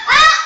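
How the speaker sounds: short mock guffaw of the grasshopper. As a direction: laugh it off, carefree. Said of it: "humalakak lamang si Tipaklong".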